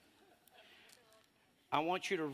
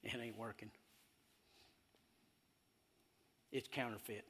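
mocking devil voice; 3 semitones lower, 7 dB quieter than usual. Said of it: "the devil's not gonna say".

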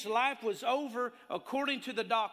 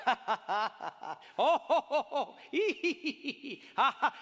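real laughing; 3 semitones higher than usual. Retold or imitated. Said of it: "all of a sudden they start".

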